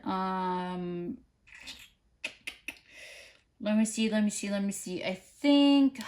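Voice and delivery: normal talking voice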